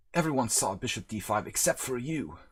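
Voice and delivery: put-on British accent, chiding the opponent; playfully hammed-up British accent. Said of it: "Love this new British accent thing".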